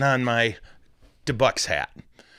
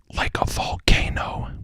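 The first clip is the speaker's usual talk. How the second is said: breathy whisper for the punchline; a little whispered joke; whispered aside. Acted. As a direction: whisper it like a secret.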